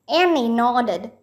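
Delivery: plain reading voice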